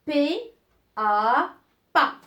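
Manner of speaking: P, then A, then pas, each said loudly and apart; sounding out. Shown as a demonstration. Teacher to pupils, sounding out a syllable. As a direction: build the syllable pas piece by piece. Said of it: "qu'est-ce qu'elle va me donner".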